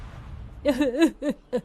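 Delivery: high-pitched sobbing; mournful crying fit; weeping. Acted. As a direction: sob in grief. Weeping over dead husband.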